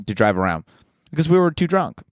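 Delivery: ordinary talk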